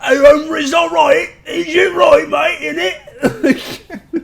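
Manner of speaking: put-on loud, high-pitched parody British accent; hammed-up British yob, shouted high; mock British accent. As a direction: squawk back in exaggerated British. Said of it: "And I'm like".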